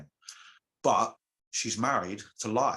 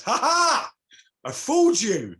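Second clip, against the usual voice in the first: much higher, loud mocking cackle into a gloat; arrogant gloating; 8 dB louder than usual. Magician gloating to the goddess he tricked, loud and smug. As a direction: crow over her like a smug trickster. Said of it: "being arrogant".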